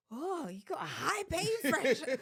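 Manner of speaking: animated imitation of surprise; impressed exclamation. Acting out impressed midwife's reaction.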